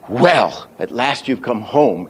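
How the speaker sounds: "Well" punched, lighter accent on "home"; big Well, small home. Shown as a demonstration. Teacher marking two accents in a line, stress on "Well" and "home". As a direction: hit "Well", then slowly lean a little on "home". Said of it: "there's a small accent".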